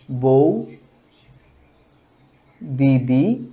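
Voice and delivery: বউ ... দিদি pulled apart slowly; slow syllable demo. Shown as a demonstration. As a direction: say বউ, pause, then দিদি. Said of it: "যদি বলি বউ দিদি". (বউ দিদি ধীরে আলাদা করে)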